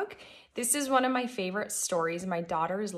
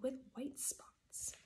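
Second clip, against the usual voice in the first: whisper; 5 semitones higher, 15 dB quieter, much slower than usual. A narrator's reading. Whispered reveal in story.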